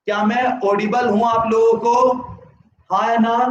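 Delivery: ordinary talk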